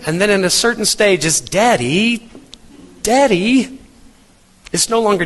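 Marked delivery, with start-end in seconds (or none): 1.4-3.8 higher, sing-song rising Daddy calls; a kid calling out for dad; child calling. Retold or imitated. Preacher imitating his children calling him, higher rising calls. Kids at a later stage.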